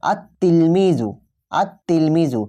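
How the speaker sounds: আত তিলমিজু with no l in the article and a held double t; silent lam, tashdid on ta. Shown as a demonstration. Teacher demonstrates pronunciation for students, doubled T in আত তিলমিজু. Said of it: "উচ্চারণটা দেখে নাও, আমরা উচ্চারণ করছি".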